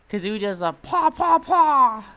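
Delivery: high, loud squawking paw; Kazooie impression. Shown as a demonstration. Showing chat Kazooie, a high loud squawk. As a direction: squawk it like a cartoon bird. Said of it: "That's the Kazooie impression".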